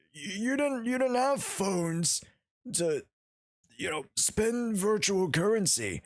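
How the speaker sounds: high, creaky put-on old geezer; old man voice. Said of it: "What about your old man voice?".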